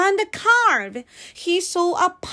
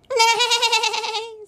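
high, trembling whinny; whinny like a horse; long quavering neigh; 8 semitones higher than usual. A narrator's reading. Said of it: "said the horse".

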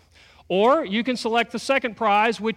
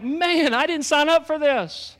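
higher, mock-exasperated groan of someone in over their head; exasperated sigh; 7 semitones higher, slower than usual. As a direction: play it like you're stuck in a mess you never chose. Exasperated imagined inner voice.

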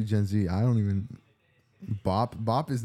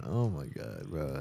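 usual speaking voice

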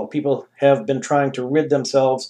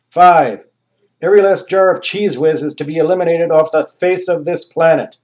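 proclaimed demands; 5 semitones higher, 6 dB louder than usual. Acted. Reading the demands.

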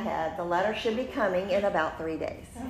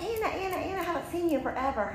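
put-on much higher and quick, gushing over an old friend; excited friend; 10 semitones higher, faster than usual. Acting out Alyssa spotting Anna on the quad, high and gushing. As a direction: squeal her name like you're thrilled to bump into her. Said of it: "she's like".

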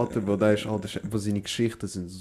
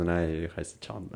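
slow reveal; 6 semitones lower, 8 dB quieter, slower than usual. Retold or imitated. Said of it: "dann habe ich es aufgelöst".

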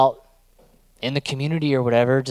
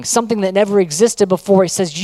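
ordinary talk, nothing marked

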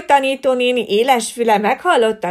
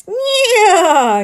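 loud high whinny; horse neigh; 10 semitones higher, 6 dB louder than usual. Acted. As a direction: neigh big like a happy horse. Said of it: "Nyerített nagyot Leopold".

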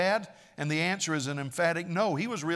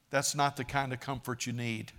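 usual speaking voice

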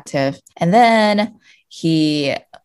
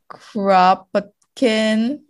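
put-on slow, drawn-out Russian accent; 7 semitones higher, much slower than usual. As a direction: say the name with a mock Russian accent. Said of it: "You have to do a Russian accent".

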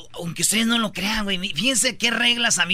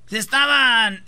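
normal talking voice

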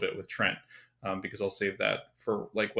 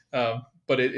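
ordinary delivery

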